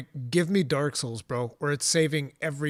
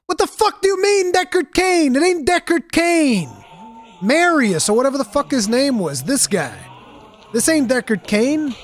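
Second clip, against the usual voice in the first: shouting in disbelief, much higher and louder; exasperated yelling; 9 semitones higher, 7 dB louder than usual. Real, not acted. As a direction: yell it, fed up with everyone. Insists angrily on the wrong name.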